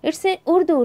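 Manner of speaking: usual speaking voice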